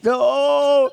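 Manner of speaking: loud, high mock "oh"; distressed wail. Other servants see what happened, loud distressed wail. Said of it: "they were greatly distressed".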